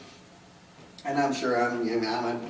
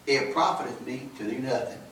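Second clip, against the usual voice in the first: raised, ringing scripture read with a laugh at first; scripture preaching; 4 semitones higher, 5 dB louder than usual. Acted. Preacher reading Corinthians to the church, ringing and raised. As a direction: proclaim the verse to the congregation.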